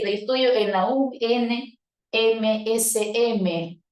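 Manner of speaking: "U N M S M" deletreado despacio, letra a letra; letra por letra. Shown as a demonstration. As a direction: deletrea "U N M S M" separando cada letra. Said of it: "lo sigue leyendo letra por letra". (sigla leída letra por letra)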